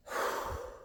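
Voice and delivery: long real exhale to recover; heavy breath out after exertion; catching breath. Real, not acted. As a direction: puff out air, winded. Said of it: "let me just breathe".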